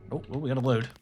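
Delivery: ordinary talk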